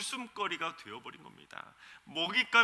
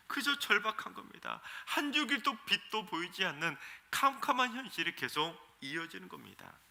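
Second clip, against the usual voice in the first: normal talking voice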